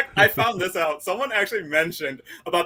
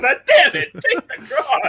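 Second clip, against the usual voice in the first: mock exasperation; 7 semitones higher, slower than usual. Acting out slapping him.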